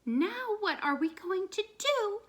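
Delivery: high small kitten voice; dog fretting in its baby kitten voice. A narrator's reading. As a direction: ask it like a tiny kitten. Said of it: "in his baby kitten voice".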